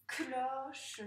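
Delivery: "Cloche" in two beats, clo-che, final e sounded; split into syllables. Shown as a demonstration. Teacher tapping syllables for a pupil, "Cloche" split clo-che. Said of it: "c'est dans laquelle qu'on entend".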